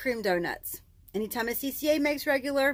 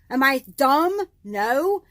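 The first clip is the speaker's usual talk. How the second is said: normal talking voice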